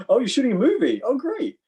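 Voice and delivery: high, loud mock enthusiasm; imitated delighted guard. Airport guard hearing about a movie, mock delight.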